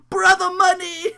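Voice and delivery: loud high laughing cry; laughing shout. Retold or imitated. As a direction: howl the name through laughter. Laughing mock wrestler name.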